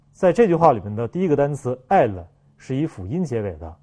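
normal talking voice

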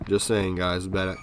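ordinary delivery

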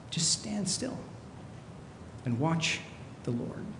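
soft, with long pauses; hushed calm. Acted. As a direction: let the noise fall away, almost hushed. Calm after describing noisy stress.

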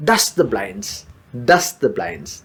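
model phrase. Shown as a demonstration. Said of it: "അതിൻ്റെ പൊടി തട്ടുന്നത് എന്താണെന്ന്".

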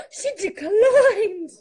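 gasp, then loud, drawn-out disbelief; shocked outcry. Real, not acted. Friend turned down for a live, gasping in shock.

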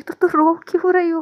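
suddenly notice her tears; startled concern. Acted. Partner, startled, asks why she is crying.

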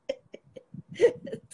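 a burst of high, airless laughter; high breathless laugh. Real, not acted. Storyteller laughing at her own punchline, high and breathless. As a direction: crack up at the punchline.

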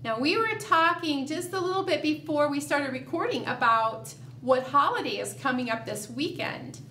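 normal talking voice

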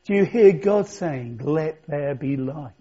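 normal talking voice

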